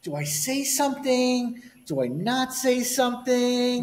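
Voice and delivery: high, slow torn inner voice; mock dithering. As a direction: act out the indecision, slow. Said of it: "and I'm like".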